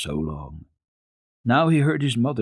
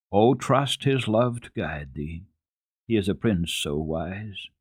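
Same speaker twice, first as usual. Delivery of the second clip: slow, solemn poem recitation with swelling lines; recited hymn. A narrator's reading. Heidi reciting the hymn to the doctor, slow and reverent. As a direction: recite the verses reverently, like a prayer.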